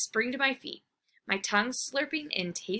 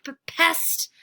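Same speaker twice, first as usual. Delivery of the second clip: fierce bark; 4 dB louder than usual. Acted. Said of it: "I bark in my fiercest voice".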